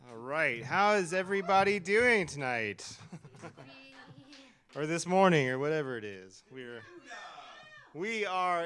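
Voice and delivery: hyped host working the crowd; in front of an audience. Acted. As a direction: project out to a live crowd like an MC. Host hyping a live audience.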